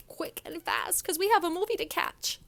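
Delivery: high-pitched mock customer voice; demanding customer. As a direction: mimic an entitled diner in a rush. Mimics a rushed, demanding customer.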